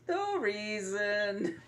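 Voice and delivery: mock deadpan. Imitating Talon staring over at Ryder, exaggerated deadpan. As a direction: stretch both words out, mimicking a suspicious stare. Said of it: "just deadpan all the way over".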